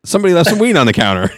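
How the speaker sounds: high, fast laughing words; laughing relief. Retold or imitated. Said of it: "it was like".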